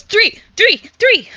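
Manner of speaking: high, loud, eager repeating; excited. Acted. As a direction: blurt it out eagerly. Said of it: "He nods furiously and says".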